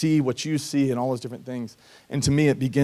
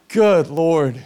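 awed exclamation; 4 semitones higher, 7 dB louder than usual. Real, not acted. Seeing his gorgeous future wife.

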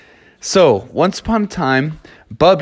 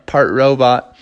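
usual speaking voice

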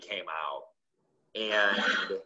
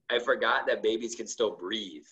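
ordinary talk, nothing marked

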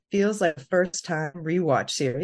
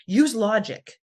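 nasty; 5 semitones higher, 4 dB louder, much slower than usual. Retold or imitated. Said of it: "she's like; gets a little nasty".

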